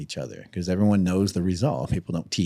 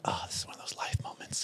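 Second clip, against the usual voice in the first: whispered, awed inner realisation; whispered thought; 15 dB quieter than usual. Retold or imitated. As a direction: breathe it to yourself, stunned. Inner thought before a fight.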